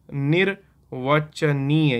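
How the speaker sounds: अनिर्वचनीय said very slowly, a-nir-va-cha-nee-ya, syllable by syllable; slow demonstration. Shown as a demonstration. Teacher demonstrates the word अनिर्वचनीय slowly for students. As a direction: stretch out अनिर्वचनीय so the र is heard before व. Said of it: "यही बोलते हैं".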